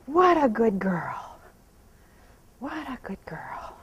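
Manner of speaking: slow, hushed whispered praise. Real, not acted. Trainer praising her standing mare in a slow whisper. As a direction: whisper it slowly, almost breathless.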